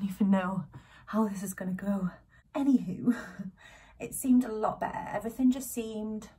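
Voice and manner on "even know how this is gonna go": whispering. Real, not acted. Breathless aside about gym.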